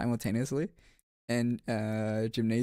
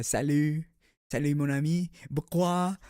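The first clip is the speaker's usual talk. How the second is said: put-on higher-pitched French phrases with an accent; French greeting; 5 semitones higher, 4 dB louder than usual. Showing off some French to chat, higher French-accented voice. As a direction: call it out brightly in a French accent. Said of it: "you can be like".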